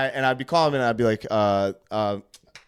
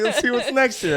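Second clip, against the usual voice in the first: higher and louder, teasing; playful mock excitement; 10 semitones higher, 7 dB louder than usual. Testing the hotel staff, raised playful glee. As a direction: egg them on with glee.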